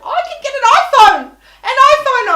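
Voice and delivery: screaming at the phone agent. Retold or imitated. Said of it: "I'm screaming into this phone".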